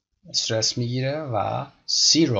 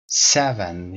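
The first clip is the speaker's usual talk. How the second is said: "Seven" with the "se" a bit louder; stressed first syllable; 3 semitones lower, 5 dB louder than usual. Shown as a demonstration. Teacher drilling the class on stress in "Seven". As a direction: lean on "se" in "Seven". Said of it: "یعنی سه بلندتر شنیده بشه".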